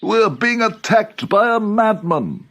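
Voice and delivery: high, slow, panicky cry; panicked friends. A narrator's reading. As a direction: cry out terrified of the madman.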